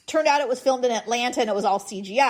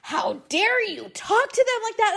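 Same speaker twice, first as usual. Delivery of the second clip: lightly voiced outraged husband, much higher; mock horrified husband; 10 semitones higher than usual. Wife imitating her horrified husband's scolding. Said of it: "He's like".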